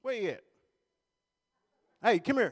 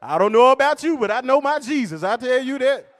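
preacher shout; 3 semitones higher, 10 dB louder than usual. Acted. Preaching to the crowd.

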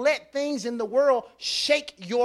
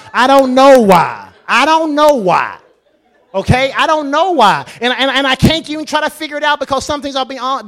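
preaching at full force; 3 semitones higher, 11 dB louder than usual. Acted. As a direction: hammer each I don't know why.

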